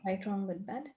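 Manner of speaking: quick, soft, murmured recital of a set formula; rote clearing formula. Acted. Coach reciting the clearing statement for the client, quick and soft. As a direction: rattle off the clearing words under your breath.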